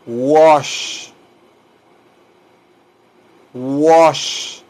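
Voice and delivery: careful isolated "wash", drawn out; model pronunciation. Shown as a demonstration. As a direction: stretch "wash" slowly for learners. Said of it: "Se pronuncia así".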